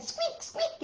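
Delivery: very high, soft squeak squeak; tiny mouse squeak. Acted. Storyteller squeaks softly for the mouse. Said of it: "the mouse said".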